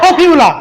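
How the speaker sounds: "পপিউলা" with puffed p and silent final r; correct English pronunciation. Shown as a demonstration. Teacher repeating the correct popular, aspirated. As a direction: glide "pyu" in "পপিউলা". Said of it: "সঠিক উচ্চারণ কি হবে?".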